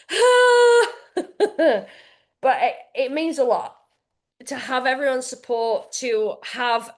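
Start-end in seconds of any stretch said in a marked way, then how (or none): 0.1-1.9 high, loud laughing wail; a squeal of overwhelmed tears, half laughing; mock wail. Mother-to-be recalling crying while writing, laughing wail. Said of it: "I was like".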